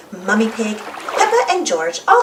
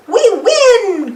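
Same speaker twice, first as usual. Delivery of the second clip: high, loud cheer; cry out; 10 semitones higher, 6 dB louder than usual. Acted. Danny cries out as they win the race, high and loud. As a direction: shout it in triumph. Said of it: "cries Danny".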